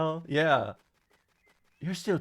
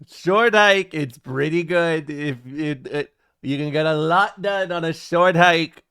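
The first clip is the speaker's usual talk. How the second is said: Trump impression; 6 dB louder than usual. Trump bragging to Bubba about the hike, loud. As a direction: sell it like a Trump rally boast. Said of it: "Trump tells Bubba how great a short hike is".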